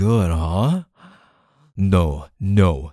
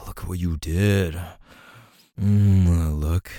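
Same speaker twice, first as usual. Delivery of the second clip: usual speaking voice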